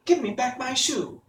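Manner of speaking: put-on high, girlish voice with mild anger; indignant child demanding, pitched well up; angry little girl. A narrator's reading. Dorothy demands her shoe back from the witch, angry. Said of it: "grew angry and said to the witch".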